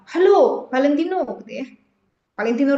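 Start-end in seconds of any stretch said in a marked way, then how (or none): 0.1-1.2 high, lively hello on the phone; bright phone greeting. Shown as a demonstration. Teacher demonstrating a lively phone greeting. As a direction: lift the "Halo" up high and cheerful. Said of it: "Contoh intonasi itu misalnya nelfon".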